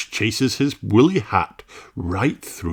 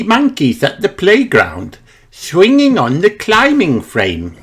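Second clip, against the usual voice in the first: put-on higher little pig girl, cheerful; Peppa voice; 8 semitones higher, 4 dB louder than usual. A narrator's reading. Peppa telling about her daddy, lightly higher cheerful girl voice.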